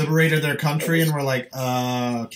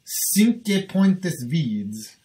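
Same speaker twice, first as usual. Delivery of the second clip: very slow French pronunciation; drawn-out fake French; mock French accent; 4 semitones higher, much slower than usual. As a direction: say it like a snooty Frenchman.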